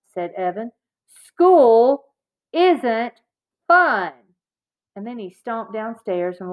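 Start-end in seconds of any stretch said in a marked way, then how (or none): 1.1-4.1 word by word, with pauses between; emphatic, stubborn one-word-at-a-time retort; angry insistence. A narrator's reading. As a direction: punch each word separately, starting loud. Angry brother spells out his refusal.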